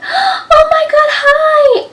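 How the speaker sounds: very high, loud squealed hi; excited greeting. Retold or imitated. To a stranger she mistook for a coworker, squealing with joy. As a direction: squeal it like spotting an old friend. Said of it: "I was so excited".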